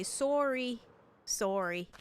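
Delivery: "Sorry" with a rounded Canadian "sore-ee" vowel. Shown as a demonstration. Streamer showing how Canada says it, Canadian "Sorry" as "sore-ee". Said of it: "To be in Canada, I'd have to say".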